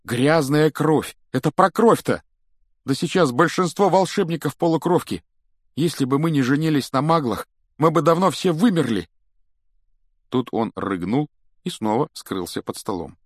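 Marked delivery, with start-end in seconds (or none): 0.0-9.1 high, loud, agitated character voice; high-pitched exasperated rant; indignant young wizard. Acted. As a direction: argue it hotly in a raised pitch.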